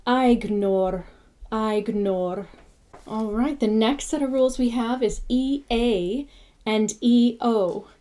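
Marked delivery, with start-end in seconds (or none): 0.1-2.5 aignor said EYE-gnor. Shown as a demonstration. Teacher sounding out aignor twice. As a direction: aignor opening with the I of dine. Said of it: "And it's".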